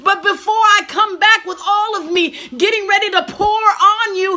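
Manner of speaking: fervent voice of God pleading; voicing God. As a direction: speak as God, aching and urgent. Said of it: "he's like".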